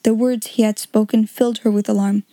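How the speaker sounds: plain reading voice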